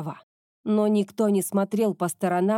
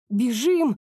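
shout; 4 semitones higher than usual. Acted. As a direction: yell it as a warning. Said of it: "крикнула Кёко".

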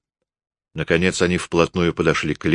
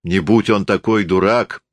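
usual speaking voice

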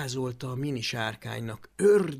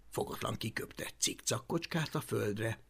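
usual speaking voice

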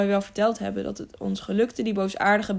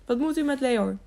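mildly indignant, higher question; angry; 6 semitones higher than usual. A narrator's reading. Innkeeper challenges knights about his servant, angry. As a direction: challenge them crossly. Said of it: "zei de waard boos".

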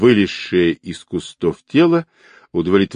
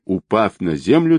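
plain reading voice

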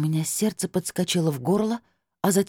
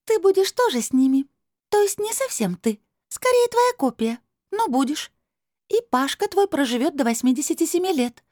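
high, louder otherworldly negotiator; hard crystal voice; 11 semitones higher, 3 dB louder than usual. A narrator's reading. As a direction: lay out a deal firmly, pitched up. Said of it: "Голос стал жёстким". (высоким жёстким голосом)

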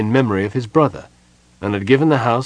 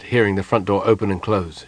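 ordinary delivery, nothing marked

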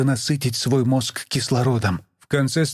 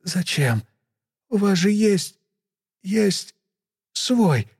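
sleepy, stifling a yawn; 7 semitones higher, much slower than usual. Acted. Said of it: "Подавив зевоту".

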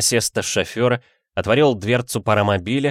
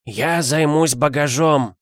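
put-on creaky old man; 6 semitones higher, slower than usual. A narrator's reading. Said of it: "проскрипел старик".

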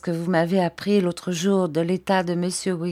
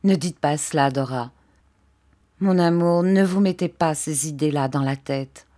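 ordinary delivery, nothing marked

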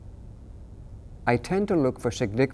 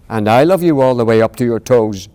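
louder playful reply; playful big hare; 11 dB louder than usual. Acted. Big hare swinging his son overhead, louder playful reply. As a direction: swing him up as you say it. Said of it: "said Big Nut Brown Hare".

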